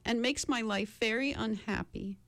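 tearful sorrow. A narrator's reading. The lion confesses his great sorrow, mildly tearful. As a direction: lament with a touch of sorrow. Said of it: "wiping a tear from his eye".

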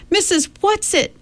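high, desperate call. A narrator's reading. Meg calls for Mrs Whatsit, high and desperate. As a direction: call into the empty room. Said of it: "Meg called".

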